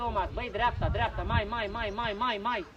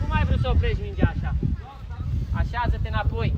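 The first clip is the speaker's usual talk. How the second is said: raised, low-pitched telling-off; loud scolding; 4 semitones lower, 6 dB louder than usual. Real, not acted. Coach tells off Darius over a missed ball, loud scolding. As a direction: scold the player loudly across the pitch.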